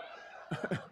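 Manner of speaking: quiet airy chuckle; a little breathy laugh at himself; soft breathy laugh. Real, not acted. Laugh after counseling joke.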